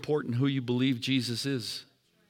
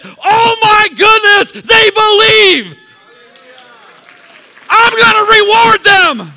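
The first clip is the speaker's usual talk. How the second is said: much higher, slow, ringing shout of delight; God marveling; 17 dB louder, slower than usual. Pretend. God amazed at people who believe, high joyful shout. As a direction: cry out in joyful wonder. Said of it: "And he says".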